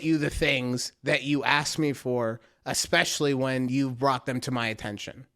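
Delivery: low, measured, sincere-sounding reenactment; calmly concede, quick and low; earnest apology. Retold or imitated. Acting out his reasonable apology.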